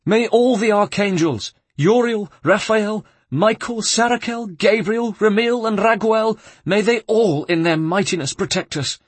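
high, loud appeal to the archangels; horrified cry. A narrator's reading. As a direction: cry out for heaven's protection in terror. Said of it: "drew back in horror and cried".

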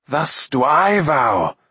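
imperious, drawn-out defiance; flung-back vow. A narrator's reading. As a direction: throw the vow back, stretching each word. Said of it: "Akron flung back".

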